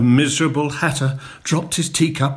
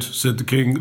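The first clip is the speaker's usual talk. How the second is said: usual speaking voice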